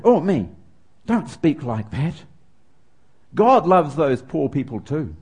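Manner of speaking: slow, preachy correction; mildly smug Western male scolding a child; superior rebuke. Retold or imitated. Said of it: "I felt very superior in my answer".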